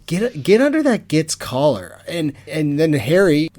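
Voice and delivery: normal talking voice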